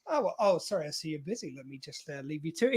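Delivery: soft, low imitated excuse-me; mock-discreet murmur, backing away; awkward apology. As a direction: trail into a hushed, halting apology. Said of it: "Picard's like".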